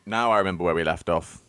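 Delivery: ordinary delivery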